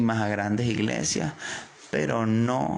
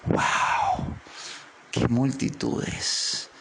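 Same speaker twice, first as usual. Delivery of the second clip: breathy hushed wow, slow; hushed wonder at the crowds; awed Jesus; much slower than usual. Pretend. Jesus voiced in awe.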